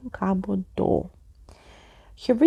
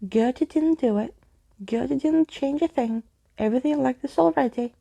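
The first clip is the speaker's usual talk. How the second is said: put-on ghost girl pleading; 5 semitones higher than usual. Ghost girl Gertie insisting to Skeleton Keys. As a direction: insist you're innocent, quick at the end.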